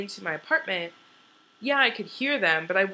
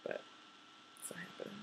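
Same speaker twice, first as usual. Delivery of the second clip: whispered; 22 dB quieter, faster than usual. Real, not acted. Whispered resignation after the woo.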